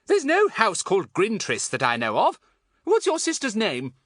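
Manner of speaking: loud, high-pitched, impatient questioning. A narrator's reading. Thin woman challenging a lost stranger, impatient.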